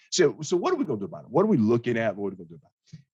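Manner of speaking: ordinary talk, nothing marked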